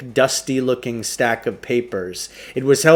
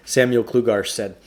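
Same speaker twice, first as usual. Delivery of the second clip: plain reading voice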